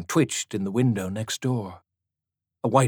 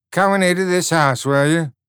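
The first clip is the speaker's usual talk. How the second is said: louder, accusing question; menacing tan; 7 semitones higher, 6 dB louder than usual. A narrator's reading. Tan to the girl, loud and accusing. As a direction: pin her down.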